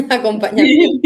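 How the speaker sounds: amused, laugh bubbling into the word. Real, not acted. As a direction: break into a laugh as you say it. Laughs at the long compound term.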